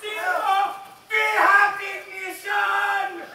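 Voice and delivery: high, slow, drawn-out proclamation; high-pitched triumphant announcement; triumphant climax. Acted. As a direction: stretch it out like a launch call. Countdown ends in triumph.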